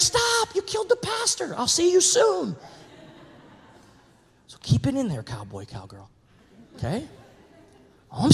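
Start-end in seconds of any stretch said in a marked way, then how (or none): 0.0-2.5 high, comic angel voice; mock angel. Angel scolding the trigger-happy churchgoer, high and comic. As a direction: play the wounded angel for laughs.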